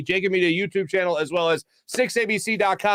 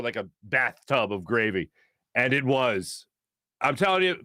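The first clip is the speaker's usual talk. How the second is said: normal talking voice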